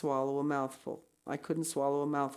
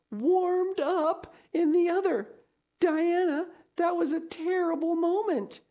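melodramatic; 13 semitones higher, 6 dB louder than usual. Acted. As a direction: tell it like a catastrophe, voice pitched way up. Anne dramatically retells a terrible moment.

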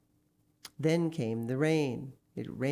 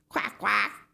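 loud, high double quack; imitated duck, high and forceful; 6 semitones higher, 6 dB louder than usual. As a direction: answer as a duck on the phone. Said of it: "said a voice at the other end".

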